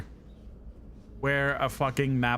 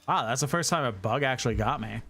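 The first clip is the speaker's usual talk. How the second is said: ordinary talk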